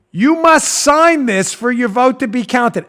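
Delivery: slow, deliberate bold-print readout; mock emphatic reading. Host reading the ballot's bold warning, slow mock emphasis. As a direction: weigh out every word for the clueless.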